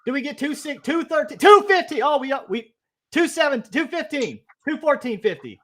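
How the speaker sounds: high, stretched auctioneer calling. Pretend. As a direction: call out bids like an auction. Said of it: "just like an auctioneer".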